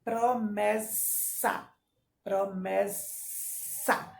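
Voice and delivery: "promessa" very slow, the ss hissed long; slow "promessa" with a snake-like sss; hissing S. Shown as a demonstration. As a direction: stretch the ss in "promessa". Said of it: "Todas têm um sonzinho da cobra".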